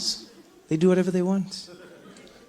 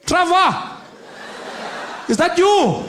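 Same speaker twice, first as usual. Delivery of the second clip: security guard; 10 semitones higher, slower than usual. Retold or imitated. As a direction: call up to him, surprised. Security guard recognizes him.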